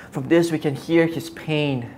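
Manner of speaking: ordinary talk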